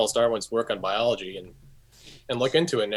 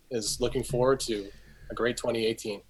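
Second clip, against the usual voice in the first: usual speaking voice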